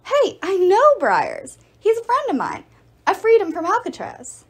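light up with happy recognition; excited. Acted. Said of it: "Percy's face brightened".

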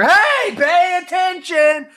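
high, loud, slowed demand; loud yell. Acted. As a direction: yell at the dog to pay attention. Yelling at the dog.

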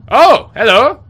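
high, loud startled hello; surprised. Real, not acted. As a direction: react to a sudden face. Something appears suddenly.

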